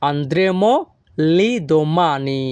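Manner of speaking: very slow, careful Italian sentence; slow Italian model. Shown as a demonstration. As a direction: spell it out slowly for learners. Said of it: "তখন আমাদের বলতে হবে কি?".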